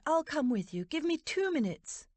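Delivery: high, carrying appeal; calling out. A narrator's reading. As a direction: call out urgently to stop her. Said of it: "she called out".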